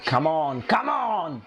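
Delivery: usual speaking voice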